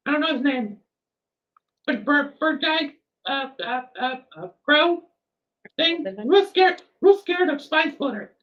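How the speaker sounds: a flustered, eager-to-please character; nervous NPC voice. Acted.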